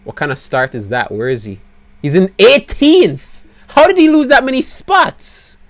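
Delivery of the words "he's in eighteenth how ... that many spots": much higher and louder, incredulous; outraged disbelief. Real, not acted. Team boss seeing his driver drop places, high incredulous cry. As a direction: shriek it in disbelief.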